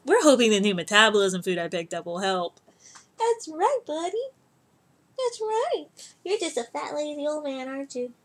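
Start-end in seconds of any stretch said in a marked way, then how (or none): 3.2-8.1 very high cooing pet voice. Real, not acted. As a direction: tease the fat cat fondly. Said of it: "I talk to my cats like they're children".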